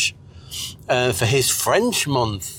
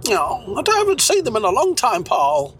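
put-on old man voice; 8 semitones higher than usual. High character voice again.

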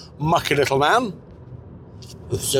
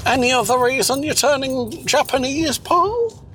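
put-on high old voice; 11 semitones higher than usual. High character voice.

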